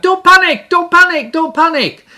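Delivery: high, loud panicked shout, fading; Corporal Jones shouting. Retold or imitated. Preacher imitates Corporal Jones yelling in a panic. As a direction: yell it like a flustered old soldier. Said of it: "he'd shout out".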